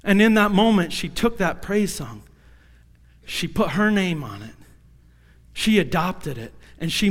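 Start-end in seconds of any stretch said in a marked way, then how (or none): none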